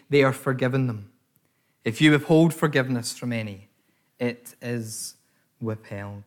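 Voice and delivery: low, measured Bible reading; scripture reading. Acted. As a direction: read the passage steadily and reverently. Reads the scripture passage.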